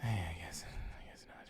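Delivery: breathy sigh into a whisper; whispered resignation. Real, not acted. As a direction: let it fade to a whisper. Sighs, trails off.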